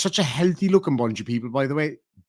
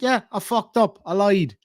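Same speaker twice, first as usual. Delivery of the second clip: mock confession; 6 semitones higher than usual. Models the admission he wants.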